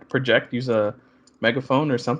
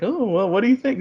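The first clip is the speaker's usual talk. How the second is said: higher-pitched mock-casual question; udawana niewinność; 8 semitones higher than usual. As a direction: play it coy, pitched up. Acting out playful surprise reveal.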